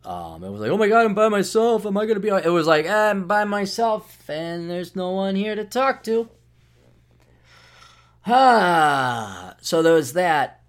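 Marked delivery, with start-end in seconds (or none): 0.7-2.5 higher, mildly panicked self-talk; mock panic. Host acting out fear of being by himself, mock-panicked. 2.9-6.3 slow, higher, drawn-out mope; lonely moan. Acted. Host acting out nights with no one to talk to, mopey. 8.3-9.5 drawn-out falling "ah" sigh; long weary sigh. Acted. Host sighing over being alone at night, weary.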